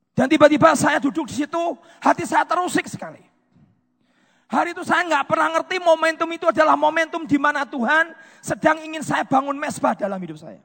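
loud, high testimony from the pulpit; impassioned preaching. Real, not acted. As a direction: preach it with rising urgency, voice lifted. (kesaksian lantang penuh semangat)

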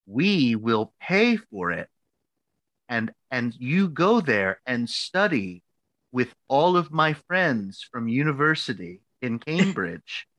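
stretched words, heavy pauses; mock-patient, very slow explanation; slow, deliberate insisting. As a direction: spell out the plan as an insistent mentor. Retold advisor spelling out the plan.

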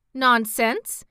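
short scornful snap from the hen; a scornful hen's retort; snapped. Acted. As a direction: snap it back dismissively. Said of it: "snapped Billina".

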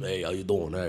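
low, deep New York greeting from the chest; deep New York uncle. Retold or imitated. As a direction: drop the voice way down like a big tough uncle. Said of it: "the deep".

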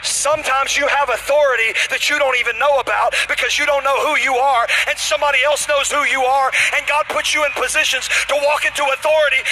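high, loud preacher climax; fired-up sermon delivery; full-force preaching. Real, not acted. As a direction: drive the point home in a sustained shout.

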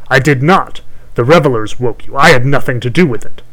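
loud, indignant crone voice; angry hag. Acted. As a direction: protest like an offended witch. Hag argues back.

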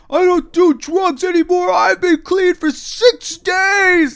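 very high, drawn-out wail; loud, slow screechy caricature; homeless man impression. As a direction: protest innocence in a mock scream. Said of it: "I heard him go".

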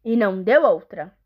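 ordinary delivery, nothing marked

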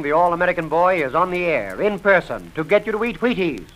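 ordinary delivery

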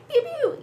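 very high, playful pew-pew; blaster noises. Retold or imitated. Leia firing at stormtroopers, high mock blaster sounds. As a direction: imitate laser shots in a high squeal. Imitating Leia's blaster shots.

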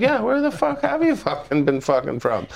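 high-pitched mock Canadian reply; imitated sweary Canadians. Everyone answers the swearing friend, imitated in a high voice. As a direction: imitate the locals, pitched well up. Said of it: "Everyone's like".

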